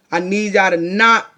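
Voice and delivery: normal talking voice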